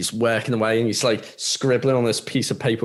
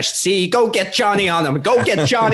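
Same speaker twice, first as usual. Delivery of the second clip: 1930s gangster; 7 semitones higher than usual. Retold or imitated. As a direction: boss around like a hammed-up 1930s mobster. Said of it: "Why is he from the nineteen thirties".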